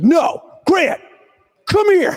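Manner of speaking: high shouted call across the room. Retold or imitated. Player calling the reporter over, high shout. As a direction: yell for him to come over, mock urgent.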